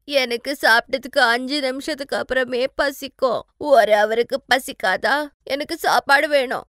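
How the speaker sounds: loud whiny hungry wail; tearful demanding. Acted. Hungry bride demands food from husband, tearful and demanding. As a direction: whine and insist as if still crying.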